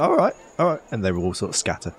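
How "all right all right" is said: high, flustered backing off; old villager backs off from the cart. Acted. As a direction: retreat grumbling. Old people scatter when shouted at.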